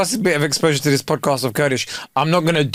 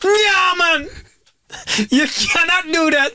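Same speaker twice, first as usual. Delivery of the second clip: high-pitched, wailing protest; comic indignant squeal; mock outrage; slower than usual. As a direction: whine it like a hammed-up tearful complaint. Acted outrage at toilet refusal.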